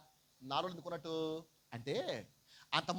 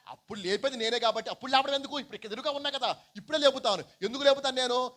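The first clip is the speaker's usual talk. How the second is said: high, loud insistent preaching; emphatic declaration; 7 semitones higher, 7 dB louder than usual. Acted. Preacher voicing Jesus telling Martha he will raise now, emphatic declaration. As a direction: drive it home high and forcefully.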